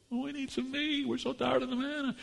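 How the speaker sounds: mock-pitiful moaning crowd; mock wailing. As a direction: parody the complainers. Families wailing at their tents.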